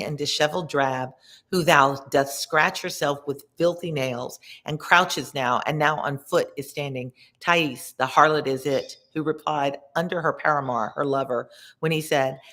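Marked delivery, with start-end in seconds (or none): none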